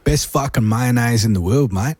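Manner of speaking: broad mock Australian accent; hammed-up Australian impersonation. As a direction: put on a thick Aussie accent. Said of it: "do an Australian accent".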